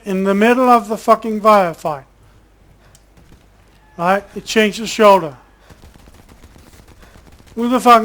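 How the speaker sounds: incredulous angry complaining; exasperated rant. Real, not acted. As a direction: vent loudly at a pointless animation. Ranting at game animation.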